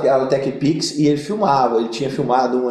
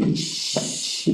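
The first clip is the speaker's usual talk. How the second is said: scared whisper; 8 dB quieter than usual. Retold or imitated. Recognized by couple, getting scared.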